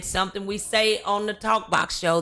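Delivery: usual speaking voice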